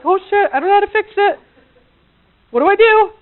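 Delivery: high, loud comic panic; mock panic. Guys unable to fix it, mock panic. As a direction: play a flustered guy at a loss. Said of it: "This is scary".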